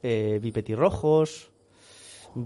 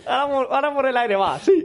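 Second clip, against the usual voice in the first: mocking the quote, higher and laughing; laughing mock echo; 11 semitones higher, 5 dB louder than usual. Friend echoes the villager's claim, laughing mockery. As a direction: parrot it back through laughter.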